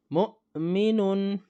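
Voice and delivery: مؤمنون drawn out slowly, the hamza stopped sharply at its point of articulation; slow demo pronunciation. Shown as a demonstration. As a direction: sound out مؤمنون slowly with a clear catch on the مؤ. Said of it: "جو example آپ کے سامنے ہیں".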